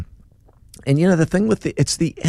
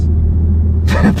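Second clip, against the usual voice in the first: delighted laugh; 5 dB louder than usual. Real, not acted.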